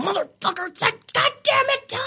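raging gamer yell, pitch shooting up; from another room. Retold or imitated. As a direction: curse out a teammate in rage. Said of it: "you can hear me in the other room".

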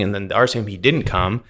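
ordinary delivery

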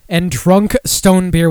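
put-on drunk said slowly and pointedly; stress on drunk. Shown as a demonstration. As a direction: lean on the word drunk. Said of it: "Yes, they said drunk".

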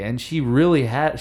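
ordinary talk, nothing marked

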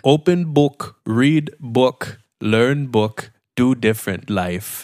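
simple brute caveman talk. Acted. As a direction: plod it out like a lightly voiced caveman.

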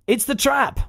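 high, drawn-out mock film quote; misquoted film line. Podcaster getting famous quotes wrong, high mock quote. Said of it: "getting famous quotes wrong".

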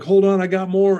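high, loud and eager; excited retold reply. Man scooping change to a little girl, excitedly retold.

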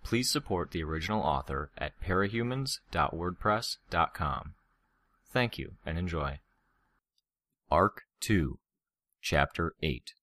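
plain reading voice

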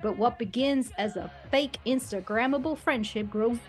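put-on Texan accent. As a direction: lean into a sweet-talking Texan accent. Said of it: "drop an octave and add a baby".

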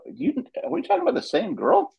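high-pitched incredulous question; incredulous grandparent retelling; mock disbelief. Mock disbelief at teachers' praise.

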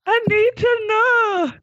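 high, slow, desperate; anguished plea. Acted. Doctor grieving her lost past self, pleading. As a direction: beg the skeleton for answers.

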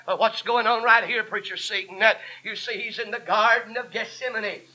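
full-force sermon with gasped breaks between phrases; fervent preaching. Real, not acted. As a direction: drive each phrase home to the congregation.

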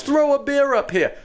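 high-pitched mock holler; imitated calling out. Man imitating his friend asking for a beer, hollered. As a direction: call up for a drink, imitating a friend.

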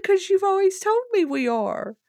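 high and loud, protesting amid laughter; excited protest. Retold or imitated. Beth protests to Daddy in a high, excited retelling. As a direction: push back, half laughing. Retold argument, rising excitement.

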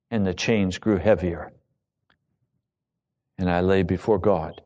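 ordinary talk, nothing marked

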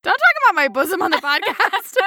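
giggling, embarrassed protest; laughing protest. Real, not acted.

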